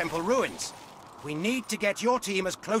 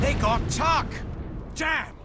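alarmed shout; 9 semitones higher, slower than usual. Acted. Comrade killed in firefight.

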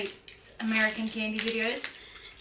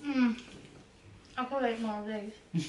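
talking while chewing; snack held at lips; mouth partly full; 5 dB quieter than usual. Real, not acted. Seen 5: Middle speaker holds food at her mouth while talking, apparently chewing.

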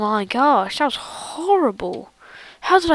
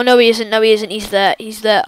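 usual speaking voice

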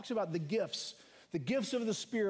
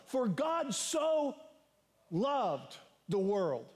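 drawn-out emphasis; much slower than usual. Acted. Preacher slows on famous verse.

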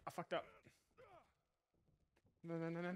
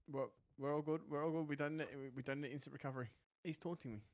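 ordinary talk, nothing marked

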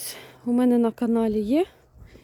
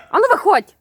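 higher, louder command to come out; firm order; 5 semitones higher, 5 dB louder than usual. Real, not acted. Owner ordering a stubborn chick out, loud and firm. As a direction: order the little one out.

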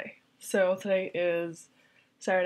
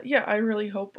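ordinary talk

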